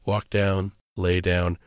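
low, emotionless step-by-step delivery; calm, cattle-like. Shown as a demonstration. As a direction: list each action with eerie calm. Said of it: "calmly set the lever".

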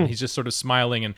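ordinary talk, nothing marked

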